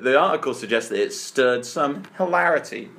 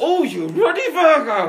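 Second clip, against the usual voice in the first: exasperated mock outburst; 11 semitones higher than usual. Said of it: "everyone's gonna go".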